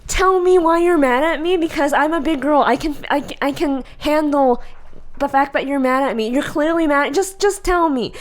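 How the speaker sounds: retelling her pleas to a passive-aggressive friend, pitched high; exasperated pleading. Retold or imitated. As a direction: push them to just admit it, voice up. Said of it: "And I'm like".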